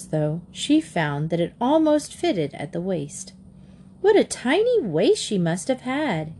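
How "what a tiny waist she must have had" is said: marveling. A narrator's reading. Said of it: "Katy marveled".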